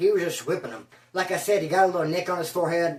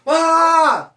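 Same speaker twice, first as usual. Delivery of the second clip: loud attack cry; battle yell; 9 semitones higher, 7 dB louder than usual. Retold or imitated. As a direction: yell as you lunge.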